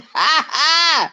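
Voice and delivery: laughing high, close to tears. Real, not acted. Host laughing at old boy cussing her out. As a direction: crack up in disbelief. Reacting to being cussed out.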